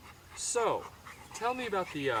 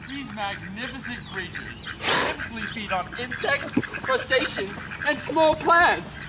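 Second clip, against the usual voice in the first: builds higher and louder, slowing down; nature documentary building to excitement; 10 semitones higher, 7 dB louder than usual. Acted. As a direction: start as calm narration, end rapturous and loud. Narration swells to a climax.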